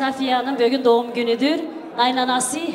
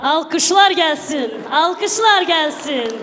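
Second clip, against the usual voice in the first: calling out; 5 semitones higher, 6 dB louder, slower than usual. Real, not acted. Host calling for applause.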